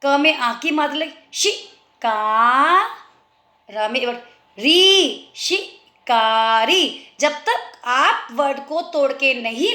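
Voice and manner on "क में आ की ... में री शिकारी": शि का री then slow शिकारी; syllable by syllable. Shown as a demonstration. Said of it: "बोलो शि".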